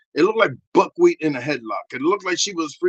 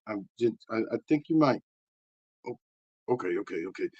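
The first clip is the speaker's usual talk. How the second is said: ordinary talk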